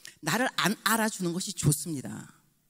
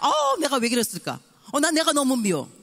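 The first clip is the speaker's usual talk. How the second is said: anguished self-reproach; 6 semitones higher, 5 dB louder than usual. Retold or imitated.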